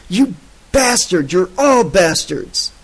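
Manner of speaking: raging. A narrator's reading. Gottman hurt and raging.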